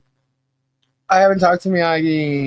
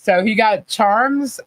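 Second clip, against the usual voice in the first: usual speaking voice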